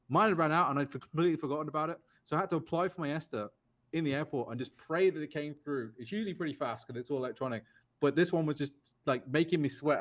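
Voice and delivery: usual speaking voice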